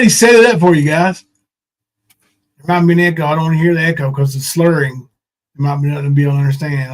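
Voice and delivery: ordinary talk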